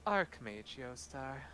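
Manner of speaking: slow, drawn-out title; archmage introduction. Acted. Archmage naming herself to the party, slow and drawn out. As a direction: savour the title slowly. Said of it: "And she says".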